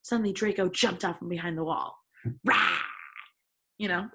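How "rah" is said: short, loud "rah"; mock jump-out roar. Reader voices Draco jumping out from the wall, mock roar. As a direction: pounce with a playful roar.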